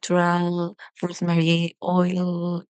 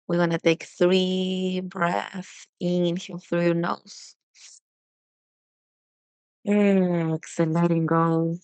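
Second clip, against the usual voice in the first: guided breathing. Acted. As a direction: ease the listener through each breath. Leads a breathing exercise.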